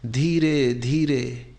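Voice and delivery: unhurried breath-guiding delivery, very slow; slow, drawn out. Real, not acted. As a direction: stretch both words to pace a slow breath.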